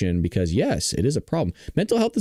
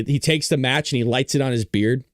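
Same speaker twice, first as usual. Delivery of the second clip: ordinary talk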